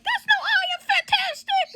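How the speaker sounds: very high, loud scolding yell; hammed-up exasperated outburst; fed-up mock shout. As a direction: act out yelling down a conceited teammate. Ben's retold outburst at teammate.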